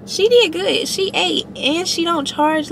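thrilled with the new hairdresser; excited praise. Real, not acted. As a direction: rave about her like she nailed it.

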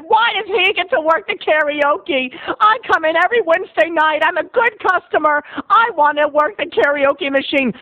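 high-pitched hammed-up protest; indignant complaint. Regular customer complains to bartender, indignant. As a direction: plead your case in a high, aggrieved voice.

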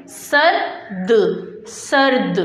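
सर्द drawn out, सर then a vowelless द; slow blended reading. Shown as a demonstration. Teacher models the right reading for class, सर्द slow and joined. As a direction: say सर्द slowly as one closed syllable. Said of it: "इस तरह नहीं पढ़ेंगे".

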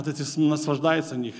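ordinary delivery

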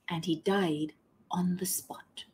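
slow breathy whisper of a death; dramatic whisper. A narrator's reading. Narrator whispers the thief's death, slow and hushed. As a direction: drop to a whisper for the grim end.

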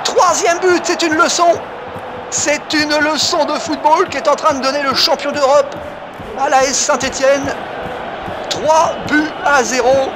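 shouted, high goal call; excited sports commentary. Real, not acted. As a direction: burst out over the third goal.

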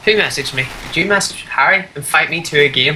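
normal talking voice

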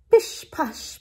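whispered hushing sounds; stealthy cat whisper. Acted. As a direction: whisper it like cat paws creeping. Acting out the cat in refrain.

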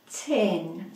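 normal talking voice

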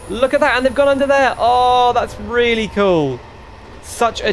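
high, loud burst of delight; excited marvelling. Real, not acted. Player spotting the train depot, high excited wonder.

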